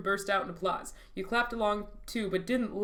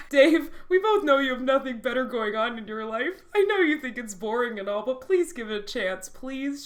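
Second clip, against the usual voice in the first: louder, higher acted plea; dramatic pleading; 4 semitones higher, 7 dB louder than usual. A narrator's reading. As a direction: beg hammily, starting forceful. Viola pleads with Dave in story.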